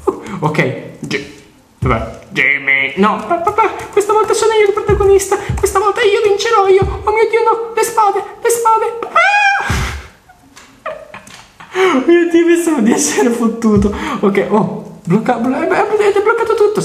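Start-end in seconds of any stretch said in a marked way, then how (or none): 3.0-9.0 put-on much higher, squeaky little boy pleading with dad; cartoonish child voice, much higher; high kid voice. Voices the kid character Jimmy. 9.1-9.6 short shrill-high yelp, louder; high cartoon scream. Kid hit by swords. 11.9-16.8 laughing. Acted. Relieved, laughing in kid voice.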